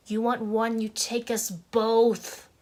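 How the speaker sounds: loud, drawn-out melodrama; theatrical outburst. Shown as a demonstration. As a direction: belt it out, stretching the end slowly. Overacted take on the monologue.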